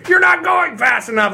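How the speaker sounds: high angry yell at traffic; road rage shout. Retold or imitated. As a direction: shout like a furious driver.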